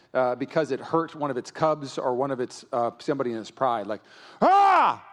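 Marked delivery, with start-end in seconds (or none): 4.4-5.0 loud, high roaring cry; lion-like roar. Acted. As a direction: roar out at the enemy. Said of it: "like a lion roaring at its enemy".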